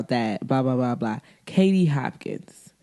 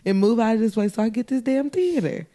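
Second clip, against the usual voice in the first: put-on bratty kid; 6 semitones higher than usual. Voicing [name] scolding.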